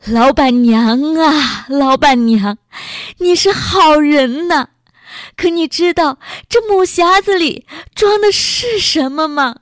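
high, grief-stricken cry; wailing grief. A narrator's reading. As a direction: wail over the dead, drawing out the words. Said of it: "禁不住放声大哭起来".

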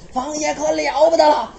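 normal talking voice

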